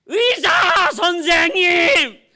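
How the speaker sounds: high, full-force drawn-out scream for help; desperate shout. Acted. Father screaming to the doctor that his son is dying, desperate shout. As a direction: yell frantically for the doctor's attention. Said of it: "막 소리 지르고 싶어요".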